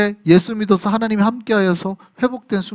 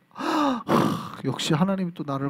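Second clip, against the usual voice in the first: softer, a sighing "하" then a hushed, touched murmur; moved sigh; 8 dB quieter, slower than usual. Retold or imitated. Preacher imitating his senior being moved, hushed sighing. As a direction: breathe it out, overcome with gratitude. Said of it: "하, 뭐 이러면서".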